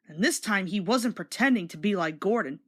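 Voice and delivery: usual speaking voice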